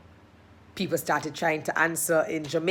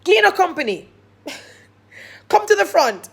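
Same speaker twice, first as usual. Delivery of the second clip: high, loud mock summons; calling out; 11 semitones higher, 8 dB louder than usual. Imitated host summoning a company to the front, calling out. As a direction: call across a conference room.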